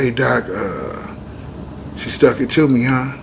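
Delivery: soft, low, slow wounded joke; weak joking murmur. Retold or imitated. Stabbed man joking to the doctor, weak slow murmur. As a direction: croak the joke out slowly, weakly. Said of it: "And I said".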